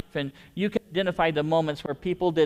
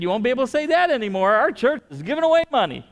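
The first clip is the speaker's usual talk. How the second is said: higher and louder, fast and triumphant; excited announcement; 8 semitones higher, 6 dB louder, faster than usual. Retold or imitated. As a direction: proudly declare it to the teller. Pastor's retold excited reply.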